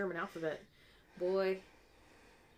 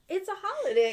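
mock protest; 11 semitones higher, 7 dB louder than usual. Acting out their own complaint.

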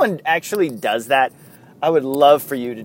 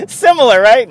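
high, loud mock-serious tease; 12 semitones higher, 13 dB louder than usual. Host teasing about wiffle ball pitchers, mock serious. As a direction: sell the joke loudly, tongue in cheek. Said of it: "No, I'm kidding".

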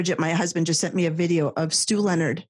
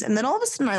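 usual speaking voice

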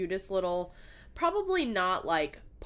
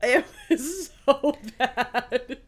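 laughing; 6 semitones higher, 8 dB louder, much slower than usual. Real, not acted. Laughing about a painful nose piercing.

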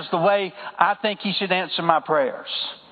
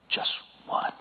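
whispered, drawn back very soft; hushed reveal; 12 dB quieter than usual. Real, not acted. Preacher answering his own question to the congregation, hushed whisper.

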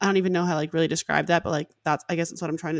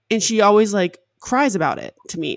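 ordinary talk, nothing marked

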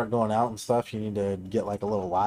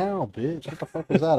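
sudden higher yelp of pain; startled pain; 6 semitones higher than usual. Real, not acted. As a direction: react to a sharp sting. Hit by rubber band.

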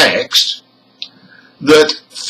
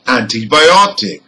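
"Antibiotic" with main stress on OT, lighter on AN. Shown as a demonstration. Teacher gives example number two, "Antibiotic" stressed on OT. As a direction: punch the second-last syllable of "Antibiotic", OT. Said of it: "The primary stress is on ot".

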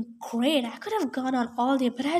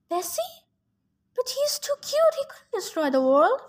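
high-pitched, disbelieving protest; high voice defending a cute creature; 9 semitones higher than usual. A narrator's reading. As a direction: object in a much higher voice.

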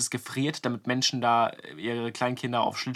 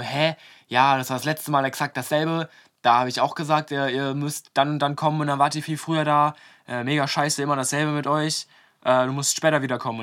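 loud, irritated imitation of a rude man; very unfriendly; 3 semitones higher, 7 dB louder than usual. Rink owner telling off the young reporter, imitated as loud and unfriendly. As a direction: scold loudly, acting out his annoyance. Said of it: "war der auch noch ultra unfreundlich".

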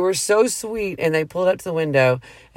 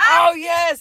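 very high, excited mock cheer; high celebratory squeal, acted out; wild retold cheering; 14 semitones higher, 4 dB louder than usual. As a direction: imitate girls going crazy with delight. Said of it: "They were going crazy".

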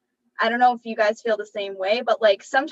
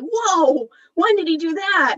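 louder, higher startled whoa; mock surprise; 6 semitones higher, 5 dB louder, slower than usual. Cohost imagining discovering a hidden plot twist, startled whoa. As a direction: act amazed at a late reveal. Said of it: "I would be like".

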